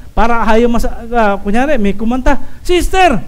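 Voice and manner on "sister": high, hammed-up praise of singing; gush, then stretch the teasing punchline; mock flattery. Church member flattering a sister who sang, mock gushing. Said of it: "That is flattery".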